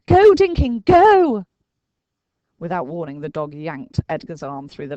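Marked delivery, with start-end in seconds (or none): 0.1-1.5 high, loud urgent cry; urging shout. A narrator's reading. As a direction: drive him on. Urging Dinking on.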